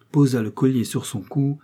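ordinary delivery

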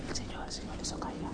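fast, hushed imitation of onlookers; very soft, unvoiced retold murmur; whispered gossip. Imitating murmuring passengers on bus.

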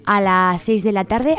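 ordinary talk

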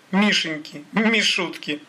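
high-pitched teasing pet names; mock-affectionate taunt, pitched well up; mocking coo. A narrator's reading. Thug mocks captive with pet names.

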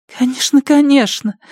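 high, hurried junkie voice; nervous. Acted. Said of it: "нервно закивала девушка".

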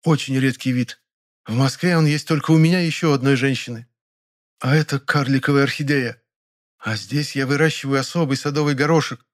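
high, proud bragging; boastful. Acted. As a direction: show off each flower. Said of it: "похвасталась она".

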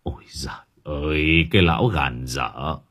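put-on low, soft, grieving old friend; tearful lament. A narrator's reading. Husband mourning his stubborn dead friend, tearful lament. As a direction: sigh it out low and subdued. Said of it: "Ông Bình rơm rớm nước mắt".

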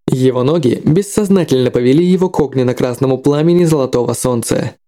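ordinary delivery

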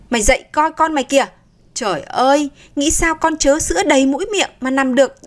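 fast, high, urgent scolding wake-up; alarmed scolding. Acted. Grandmother waking the sleeping mother, alarmed and scolding. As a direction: jolt her awake, alarmed and cross. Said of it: "đánh vào chân Nga kêu gọi".

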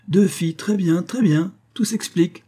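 put-on high, lilting old-lady delight; delighted exclamation. A narrator's reading. Mother seeing why Woodhouse visits, delighted exclamation. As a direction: pitch it right up, suddenly enlightened. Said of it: "s'écria-t-elle".

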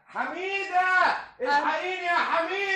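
drawn-out high call for his wife; mock desperate call to his wife; calling for help. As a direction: cry out for Hamida, stretched and high. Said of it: "بتزعق كده ليه؟".

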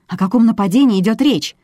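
high, insistent protest; indignant. A narrator's reading. Woman disputes the charge of assault, indignant. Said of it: "возмутилась я".

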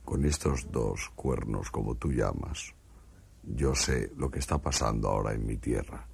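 calm the frightened man as an alien. Acted. Martian speaking to a frightened stutterer in a low alien voice.

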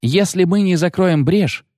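high-pitched, slow female call; shouting over the roar. A narrator's reading. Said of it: "перекричала рёв сладкая ветреница".

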